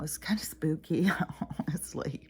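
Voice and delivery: soft whisper with a little laugh; hushed, half laughing. Real, not acted. Spooky remark whispered with laugh.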